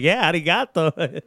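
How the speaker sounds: slow, smug "Yeah, arigato"; smug Japanese line. Retold or imitated. Fan shows off Japanese to wrestler, slow and smug. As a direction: drop it slowly like a smooth line. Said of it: "I threw that little line in there".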